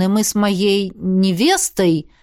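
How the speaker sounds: slow, deliberate weight on "невестой"; "невестой" carefully drawn out and emphasised; stress on last word. A narrator's reading. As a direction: set "невестой" apart and stress it pointedly. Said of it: "старательно выделил последнее слово".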